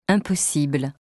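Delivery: normal talking voice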